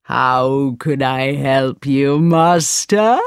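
slow, deep-toned friendly genie; a big genie asking gladly to serve; smiling genie. A narrator's reading. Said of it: "he asked with a smile".

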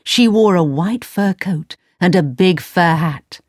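ordinary delivery, nothing marked

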